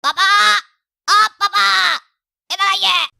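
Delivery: loud call across the house; shouting for dad. Acted. Son shouts for father from the bedroom, loud call. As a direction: yell "पापा" so he comes running. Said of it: "यहीं से जोर से चिल्लाते".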